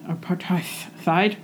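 "Apartheid." drawn out slowly, word tested syllable by syllable; slow careful try. Shown as a demonstration. Co-host testing the word "Apartheid." aloud, slowly and carefully. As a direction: sound out "Apartheid." slowly, unsure. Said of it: "I thought it was apartheid".

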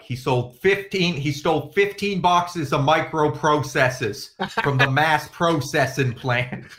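put-on slow, emphatic Boston accent; mock Boston accent. Boston local gossiping about a theft, exaggerated Boston accent. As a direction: lay the Boston accent on thick, drawn out.

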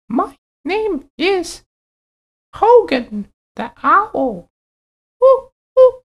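very high, slow owl voice; a friendly owl, high and hooting. Acted.

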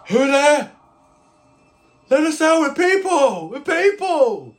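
loud, high-pitched pleading shout; high, strained plea to be heard; desperate prisoners. Acted. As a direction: cry out from the cells for rescue. GM voices prisoners pleading from cells.

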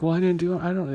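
lightly hammed-up confused denial; a hint of a flustered suspect pleading; super confused. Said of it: "He's super confused".

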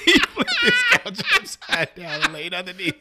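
high, loud, laughing through words. Real, not acted. Friend laughing hard while describing the couch scene. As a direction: tell it while cracking up.

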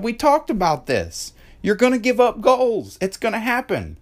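high, emphatic coaching voice; retold pep talk. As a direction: drive the point home to an upset kid. Retold firm pep talk to daughter.

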